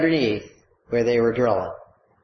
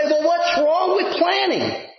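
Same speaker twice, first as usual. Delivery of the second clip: skeptical listener; 11 semitones higher than usual. Retold or imitated. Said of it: "You might say".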